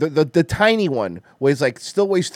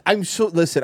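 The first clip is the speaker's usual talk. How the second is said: usual speaking voice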